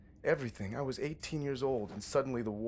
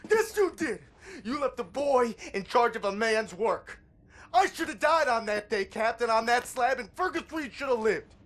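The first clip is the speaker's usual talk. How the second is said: loud, raw yelling of blame, words dragged out; furious shout; 14 semitones higher, 10 dB louder than usual. Acted. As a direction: scream the accusation at the captain. Angry quarrel with the captain.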